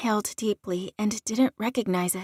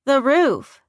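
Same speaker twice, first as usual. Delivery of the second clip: loud, higher cry of alarm; screamed warning; 4 semitones higher, 7 dB louder than usual. A narrator's reading. Friend warns of a sniper as blood flows, screaming. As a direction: shout the warning urgently. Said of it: "Camille screamed".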